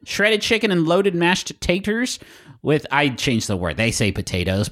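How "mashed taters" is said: higher-pitched, savored "taters"; slow, playful "taters"; folksy "taters". Pretend. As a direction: say "taters" for potatoes, drawn out. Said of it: "but I said taters".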